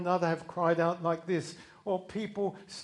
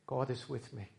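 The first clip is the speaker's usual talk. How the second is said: soft affirmation; 4 semitones lower, 7 dB quieter than usual. Shown as a demonstration. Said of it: "repeated softly".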